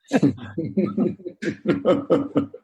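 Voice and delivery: chuckling laugh; real laugh. Real, not acted. Therapist ending a couple's role-play, real laughter.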